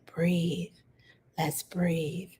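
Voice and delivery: soft whisper, breathy and gentle; said lightly. Shown as a demonstration. Caregiver soothing a baby to breathe, gentle whisper. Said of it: "I'll say it lightly".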